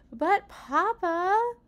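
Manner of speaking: put-on high, loud, drawn-out child's whine; whining. A narrator's reading. Young son objecting to papa's decision, whining. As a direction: whine it in protest. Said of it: "whined Quentin".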